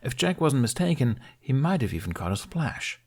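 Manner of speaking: ordinary delivery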